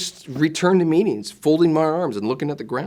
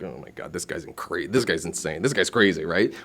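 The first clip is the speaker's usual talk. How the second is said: low, hushed aside to himself; inner thought; 10 semitones lower, 6 dB quieter than usual. Retold or imitated. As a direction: think it under your breath, low. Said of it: "I'm thinking".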